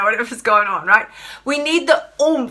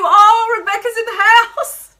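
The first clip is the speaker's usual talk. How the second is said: high, loud delighted greeting; thrilled hello to a friend; excited welcome; 11 semitones higher, 5 dB louder, slower than usual. Real, not acted. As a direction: squeal her name in.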